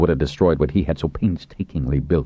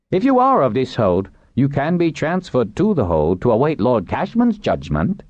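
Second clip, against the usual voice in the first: higher-pitched character voice; official addressing captives; 9 semitones higher than usual. Acted. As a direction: address the prisoners formally in a raised pitch. Narrator voices Pinch addressing captives.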